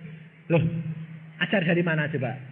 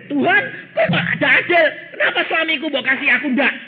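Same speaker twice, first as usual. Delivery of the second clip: high, loud crying complaint; wife's jealous wail; 13 semitones higher, 4 dB louder than usual. Retold or imitated. Wife crying out to God, jealous of her husband. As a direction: wail at God about the unfairness. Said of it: "istri saya nangis teriak-teriak".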